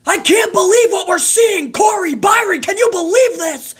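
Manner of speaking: high mock shout at the co-hosts; imitating Jimmy Smith yelling. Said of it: "the most energy I've ever seen out of Jimmy Smith".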